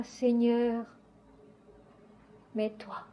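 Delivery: low, soft invocation with pauses; hushed prayer refrain. Acted. Believer thanking Seigneur and yielding to him, hushed low prayer. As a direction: repeat it softly and low, sinking at each end.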